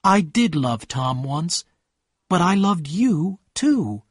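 understated narrated distress; tearful. A narrator's reading. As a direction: admit it slowly, close to tears. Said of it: "She began to cry".

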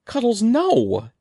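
high, loud and slow pleading cry; drawn-out frantic shout; desperate cry. A narrator's reading. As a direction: cry out to stop the hamster. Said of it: "Evan cried".

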